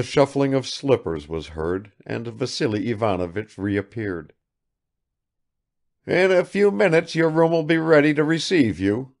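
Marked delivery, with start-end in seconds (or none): 6.1-9.1 raised, proud announcement; a touch of triumph in the old father's voice; triumphant exclamation. Acted. Father announcing the room is ready, triumphant. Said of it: "he exclaimed triumphantly".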